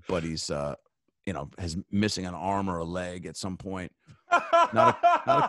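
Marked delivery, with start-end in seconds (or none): 4.3-5.5 very high laughing squeak; laughing squeal. Real, not acted. Describing pirates to a co-host, laughing squeal.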